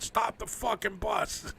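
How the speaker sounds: panicked passenger yelling at the driver, lightly replayed; retold yell. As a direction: drag out the demand to stop the bus. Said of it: "I just yelled out".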